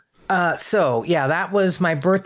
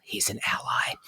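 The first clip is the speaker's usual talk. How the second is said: hushed, lower aside; confiding whisper; 4 semitones lower, 7 dB quieter, slower than usual. Retold or imitated. Fan privately notes her idol agrees, whispered. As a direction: drop to a near-whisper.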